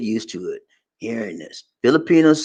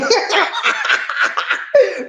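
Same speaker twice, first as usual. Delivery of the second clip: high laugh. Real, not acted.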